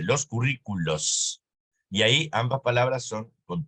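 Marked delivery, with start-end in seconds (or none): 0.0-1.4 "los currículos" slow, S ending clear; model Spanish plural. Shown as a demonstration. Teacher models the Spanish plural, slow and clear. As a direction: spell out "los currículos" slowly. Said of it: "pluralizamos en S".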